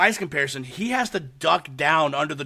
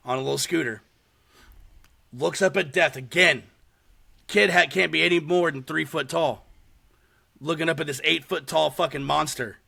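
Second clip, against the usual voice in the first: usual speaking voice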